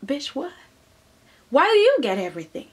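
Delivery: resentful, quick mock outburst; jealous scoff. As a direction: scoff at her like a jealous sibling. Family resenting Steffi, acted.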